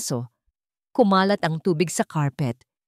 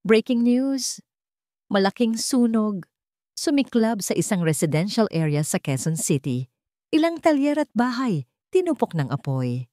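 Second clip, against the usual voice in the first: news report; 4 semitones higher than usual. A narrator's reading.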